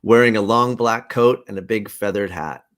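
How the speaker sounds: ordinary talk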